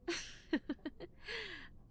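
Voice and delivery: light teasing giggle; high giggle. Acted. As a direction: giggle as you step away. Playful giggle in roleplay.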